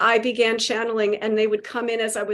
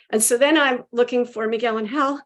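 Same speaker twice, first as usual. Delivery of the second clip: ordinary talk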